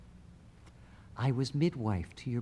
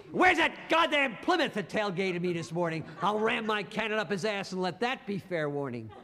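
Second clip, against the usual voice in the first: higher, laughing vengeful glee; gleeful threat; 6 semitones higher than usual. Acted. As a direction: gloat about ramming the tailgater. Jake gloating at tailgater.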